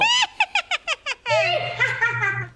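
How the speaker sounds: high shouted witch cackle. Pretend. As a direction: cackle like an old hag. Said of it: "'Cause I could do a witch laugh".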